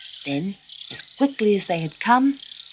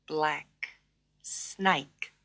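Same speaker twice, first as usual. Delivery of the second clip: ordinary delivery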